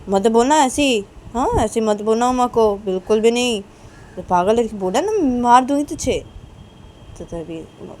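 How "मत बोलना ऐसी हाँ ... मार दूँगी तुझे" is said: loud, firm telling-off; raised, emphatic acted reprimand; scolding. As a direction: grandmother sternly warning the boy. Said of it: "Sanju को डांट रही हूँ".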